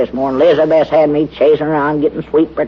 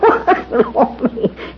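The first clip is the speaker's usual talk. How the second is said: high breathy chuckle at the joke; a quick tickled laugh; amused laugh; 8 semitones higher than usual. Acted. Abner laughs at fat turkey.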